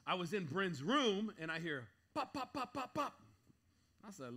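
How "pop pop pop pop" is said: mock gunshot pops. Imitating gunshots.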